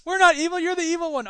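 high indignant cartoon hero. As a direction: protest like an eighties cartoon hero. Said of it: "Transformers cartoon".

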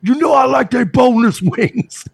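high furious yell; angry shout. Pretend. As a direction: scream it like a jealous husband. Acted abusive husband quarrel.

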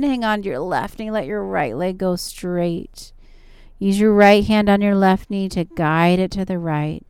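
usual speaking voice

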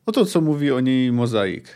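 ordinary talk, nothing marked

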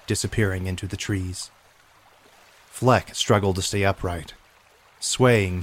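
ordinary delivery

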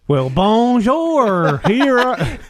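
mock French. Mocking French after UK accent talk.

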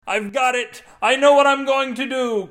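high, loud shout of discovery. A narrator's reading. Husband shouting in the kitchen, excited shout. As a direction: shout out a brainwave.

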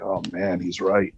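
normal talking voice

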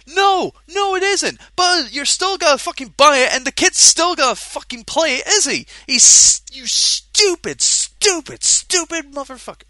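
heated rant building to cursing; furious rant. Real, not acted. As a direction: spit out the insults in real fury. Angry rant at the buyer.

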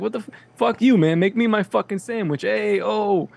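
lightly hammed-up New York accent; mock New York accent. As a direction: play an irritated New York customer. Acting New Yorker at sandwich counter.

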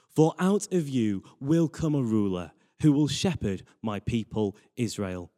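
ordinary delivery

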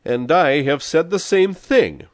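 loud, high, slow retort; match the rival's force word for word; fierce comeback. A narrator's reading. Answers the rival's fierce claim.

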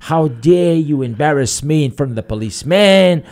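high, loud outraged husband; hollering husband. Acted. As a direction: holler at your wife in mock outrage. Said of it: "He starts hollering at the wife".

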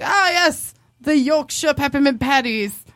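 mock-refined London English; London accent. Date trying the candy name again, London accent. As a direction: put on a London accent, savoring it. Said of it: "say it like they would in, in London".

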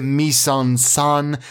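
MISE EN SUN said as "meess on sun"; wrong French pronunciation. Shown as a demonstration. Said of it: "How do we pronounce it?".

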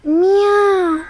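a drawn-out kitten mew; kitten meow. Acted. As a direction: stretch out the meow. Kitten meows.

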